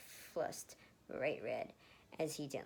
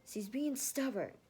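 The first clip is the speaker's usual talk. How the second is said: hissed; 8 semitones higher, 5 dB louder, slower than usual. Acted. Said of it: "Lucas hissed".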